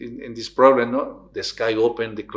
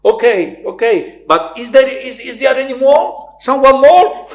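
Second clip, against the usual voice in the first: very high, loud plea; high desperate plea; 14 semitones higher, 13 dB louder than usual. Retold or imitated. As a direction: cheekily ask for someone else. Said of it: "and he said". (very high, loud plea)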